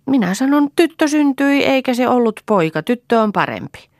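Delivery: put-on very high, loud little-girl voice; high-pitched child piping up loudly; child's declaration. A narrator's reading. Child Lea proudly repeating the news.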